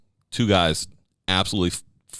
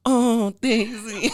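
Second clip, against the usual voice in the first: very high, slow drawn-out coo; dog-talk voice; much slower than usual. Shown as a demonstration. Owner calling Daisy for a treat, high dog-talk voice. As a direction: gush high and slow, almost orgasmic. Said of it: "like you're having an orgasm".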